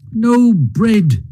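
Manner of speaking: high, doleful lament; dolorous groan. A narrator's reading. Mole groans over a meagre supper, doleful. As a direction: mourn the missing bread. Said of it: "groaned the Mole dolorously".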